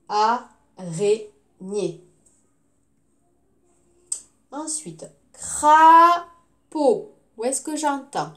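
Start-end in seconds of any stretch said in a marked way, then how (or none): 0.1-2.0 "Araignée" very slow, a - rai - gnée; syllable by syllable. Shown as a demonstration. Teacher gives pupils the answer, syllable by syllable. 5.3-7.1 "crapaud" loud, long craaa, then pau; syllable by syllable. Shown as a demonstration. Teacher gives pupils a word, loudly split into syllables.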